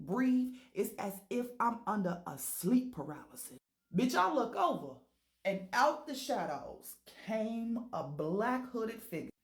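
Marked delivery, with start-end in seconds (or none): none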